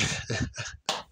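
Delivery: soft whispered chuckle; breathy quiet laugh. Real, not acted. Laughing at Maury's audience.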